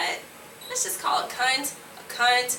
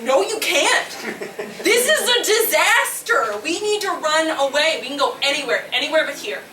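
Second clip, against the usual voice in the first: higher, louder, urgent and laughing; panicked inner voice; 5 semitones higher, 4 dB louder than usual. Retold or imitated. Inner voice pleading to run away, louder and higher. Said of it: "the other voice was like".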